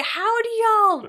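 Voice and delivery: very high, stretched-out squeal; squeaky self-mockery. Woman asking her team in disbelief, high mock squeal. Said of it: "I sound like a fucking chipmunk".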